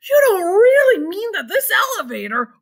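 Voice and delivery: high, loud panicked cry; grandpa's alarmed shout; panicked old man. A narrator's reading. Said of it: "shouted Grandpa Joe".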